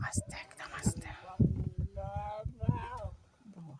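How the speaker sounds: very soft whisper; hushed warning. Real, not acted. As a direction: whisper it so nothing is startled. Warning others to stay quiet. (একদম ফিসফিস করে সাবধান করা)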